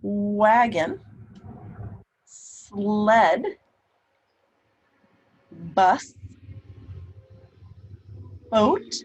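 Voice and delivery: put-on each word said loud and clear for children; name each word slowly to the class; reading word list. Shown as a demonstration. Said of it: "let's look at these words".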